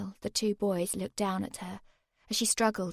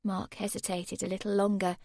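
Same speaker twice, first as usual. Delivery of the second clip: plain reading voice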